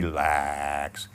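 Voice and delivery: slow, low, stretched "Relax"; a long soothing "Relax"; drawn-out relax. Shown as a demonstration. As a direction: drag "Relax" out long and easy. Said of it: "drag it out for a while".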